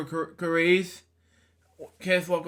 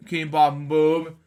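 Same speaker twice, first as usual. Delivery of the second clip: plain reading voice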